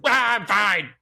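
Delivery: high, pained mock yell; retold wail of false bravado; strained insistence. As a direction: cry out that all is well while hurting. Battered faller insisting to medics.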